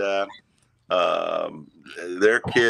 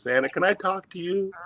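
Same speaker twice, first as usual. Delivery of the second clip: put-on little boy voice; 10 semitones higher, 3 dB quieter than usual.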